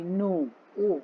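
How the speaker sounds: नो with a long closed "oh"; round, long O as in नो; O sound in no. Shown as a demonstration. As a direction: say नो, then the bare ओ as "oh". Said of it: "उसकी आवाज अलग हो गई".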